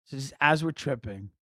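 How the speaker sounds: ordinary talk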